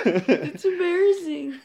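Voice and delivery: put-on high, loud, drawn-out kid voice; slow mock kid whine; little kid. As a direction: imitate the embarrassed child, stretching the word. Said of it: "Yeah, that's what he said".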